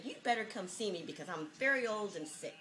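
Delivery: ordinary talk, nothing marked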